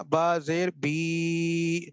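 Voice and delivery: بی with the ی held very long and slow; drawn-out vowel. Shown as a demonstration. Teacher drawing out بی so students hear the long vowel. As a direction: stretch بی into a long ee sound. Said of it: "آگے یا ساکن کر دیں".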